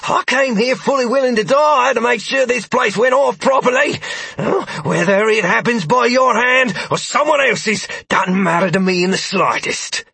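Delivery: high defiant half-orc, loud; taunting a foe he won't fear. A narrator's reading. Carver voiced, defiant half-orc.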